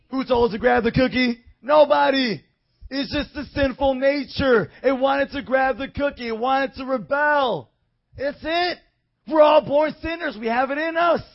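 loud, rising street preaching. Acted. Preacher pressing the man, loud and rising. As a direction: preach it at full force to the man.

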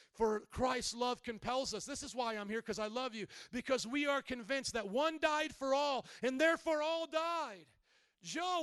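loud, high, driving sermon delivery; preaching at full force. Acted. Preacher urging the congregation to live for Christ, full-force preaching. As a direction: preach it out with rising conviction.

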